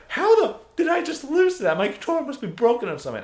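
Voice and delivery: high-pitched indignant outburst; mock pissed off. Beaten gamer blames his controller, mock pissed off. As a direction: imitate a sore loser's loud complaint. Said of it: "he got so pissed off at it".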